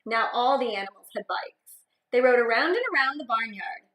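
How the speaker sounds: put-on lively read-aloud for young kids; expressive picture-book narration; storybook reading.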